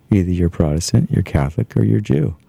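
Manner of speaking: usual speaking voice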